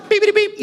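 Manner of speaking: high, chirpy alarm beeps; imitated phone timer.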